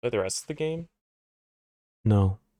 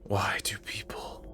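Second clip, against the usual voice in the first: whispering; 6 dB quieter than usual. Real, not acted. Streamer murmurs after shocking reveal.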